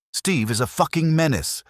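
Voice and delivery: high, sudden exasperated burst; exasperated outburst. Acted. Villager diarist fed up after Steve stole all the food, exasperated outburst. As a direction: blurt it out, fed up with the thief.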